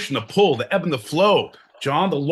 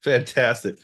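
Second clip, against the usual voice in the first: usual speaking voice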